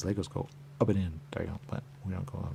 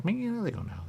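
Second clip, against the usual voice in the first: much higher voiced line; high quoted voice. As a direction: pitch it way up like a little figure. Quoted voice in narration.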